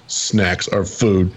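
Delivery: imitating a straight-faced, drawn-out announcement. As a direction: deliver it dead serious, drawn out word by word. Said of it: "goes, straight face".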